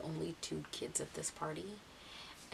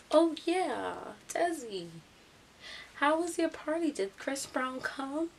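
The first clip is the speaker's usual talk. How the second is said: high, loud mock-eager taunt; loud calling out; 8 semitones higher, 7 dB louder, slower than usual. Ralph asking Desi about the party, loudly taunting. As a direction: call it across to her, stretching the ending. Imitating classmate calling out teasing question.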